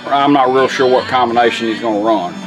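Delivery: ordinary talk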